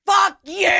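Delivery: high, loud mock "Fuck you"; a high mock shout of exasperation. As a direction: blurt it out in fake fury. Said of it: "You're like".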